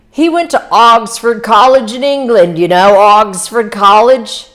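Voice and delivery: put-on high, loud voice with a weird accent; Wolfsheim. Wolfsheim tells Nick about Gatsby's college, in a weird accent. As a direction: put on an odd accent, saying Oxford like "Oggsford". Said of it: "in a weird accent".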